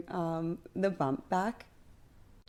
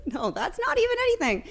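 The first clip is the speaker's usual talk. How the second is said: squeaky protest; 14 semitones higher than usual. Real, not acted. Laughing high protest.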